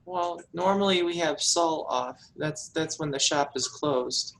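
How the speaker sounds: meek daughter. Acted. Daughter tells visitor about her father, meekly.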